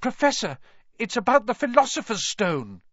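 high, insistent disclosure; reckless urgency. A narrator's reading. Harry reveals his secret to the professor, urgent. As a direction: blurt it out, caution gone. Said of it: "throwing caution to the winds".

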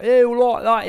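high, loud mock British greeting; loud cartoonish Brit voice; imitated British accent. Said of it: "we were doing accents all the time".

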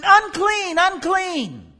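very loud, high, desperate cry. Acted. Leper approaching the crowd where Yeshua teaches, crying loudly. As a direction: wail it out across the crowd. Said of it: "he's crying".

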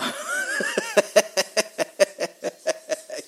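high, loud burst of laughter; real laugh. Real, not acted. Laughing at his God joke, a high real laugh.

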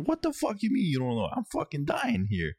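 high, incredulous mock outburst; retold exasperated panic. Snapping back at partner while fearing he's dying, retold panic. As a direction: throw it back in high-pitched, acted desperation.

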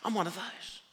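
as if it just dawned on you; sudden realisation. Retold or imitated. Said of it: "it was like".

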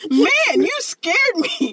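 high, drawn-out mock quote of a kid; code-switched kid. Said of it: "he trying to tell us how he code switched".

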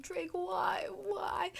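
plead, then whisper the last why; mock plea. Pleading with Drake.

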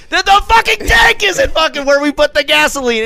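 high, loud panicked yelling; panicked shout. Retold or imitated. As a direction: shout it as the fuel spills. Gas pouring on hot engine.

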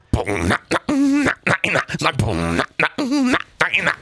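wordless vocal imitation of a jingle; mouthed soundboard jingle. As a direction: hum and mouth a sound effect. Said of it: "we recreated that exact thing".

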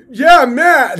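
loud, high shouted admission; mock angry yell. Angry driver shouting back at the brother.

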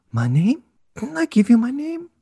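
put-on high old nanny voice, rising in surprise; Mrs Doubtfire impression. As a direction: play the nanny, taken aback. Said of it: "I'm gonna do the line".

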